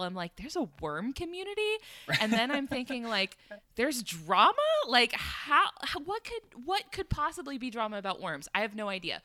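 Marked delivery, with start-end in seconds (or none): 0.4-1.7 high-pitched baffled question; retold disbelief, rising high on the question; can't believe such a thing exists; incredulous thought. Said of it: "I'm thinking to myself, first of all". 3.8-8.5 high, incredulous questioning; retold bewilderment, stumbling over the question; utterly puzzled, pitch jumping up; baffled disbelief. Acts out own baffled thoughts.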